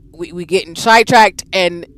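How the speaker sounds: normal talking voice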